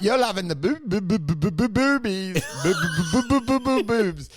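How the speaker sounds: stuck on boob, pitch climbing; stammering gag. Retold or imitated. Mocking the mate, stuttering up the scale.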